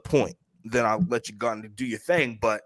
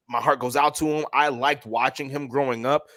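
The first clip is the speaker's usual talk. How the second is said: ordinary talk, nothing marked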